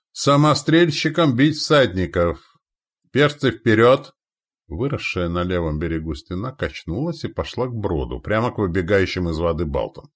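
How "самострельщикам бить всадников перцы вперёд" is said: slow, loud, high battlefield orders; barked command. Acted. Commander to crossbowmen and infantry, barked command. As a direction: call orders across the ranks. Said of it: "рявкнул Лонни".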